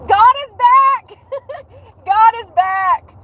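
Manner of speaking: high, slow, loud God is back; overjoyed proclamation; thrilled slow shout; ecstatic. Retold or imitated. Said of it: "I was like".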